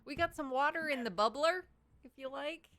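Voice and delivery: loud, high Minnesota folksy voice; Minnesota accent. Pretend. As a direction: lay on the Minnesota twang. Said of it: "Can't do the Minnesota accent up here".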